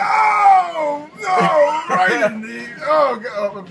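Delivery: loud, high cry; dismayed shout. Real, not acted. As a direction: yell as you get hit. Dying in game.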